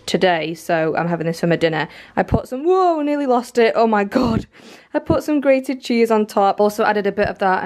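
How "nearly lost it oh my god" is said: usual speaking voice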